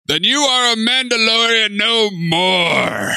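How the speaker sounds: slow, grave pronouncement; solemn Armorer line. Retold or imitated. Quoting the Armorer's verdict.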